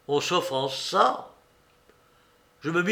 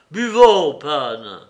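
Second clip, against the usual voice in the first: called-out toast; 11 dB louder, slower than usual. A narrator's reading. Mitya hails the pan to drink, crying out. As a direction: cry out a hearty invitation to drink. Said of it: "lui cria".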